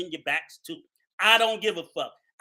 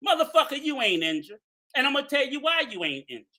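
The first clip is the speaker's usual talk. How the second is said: usual speaking voice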